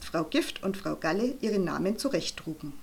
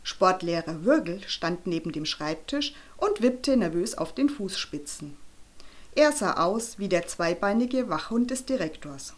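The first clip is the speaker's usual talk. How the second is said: ordinary delivery, nothing marked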